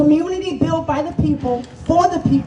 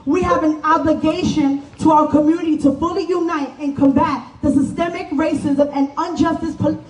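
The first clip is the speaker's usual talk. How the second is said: ordinary talk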